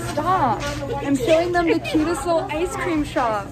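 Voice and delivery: ordinary talk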